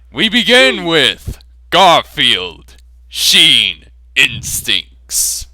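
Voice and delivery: big, loud, slow title reveal; grand title announcement. Acted. Narrator announces the fan fiction title with grand loudness. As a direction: announce it like a movie trailer. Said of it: "announcing the story title".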